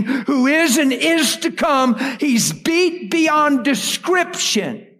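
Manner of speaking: full-force sermon climax; fervent preaching. Real, not acted. Preacher to congregation, fervent high preaching.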